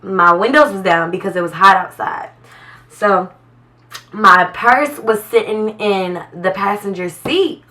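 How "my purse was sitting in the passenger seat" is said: normal talking voice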